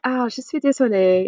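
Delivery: light mock regretful decline; polite refusal. Shown as a demonstration. Teacher acts declining a dinner invite, lightly. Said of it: "You're like".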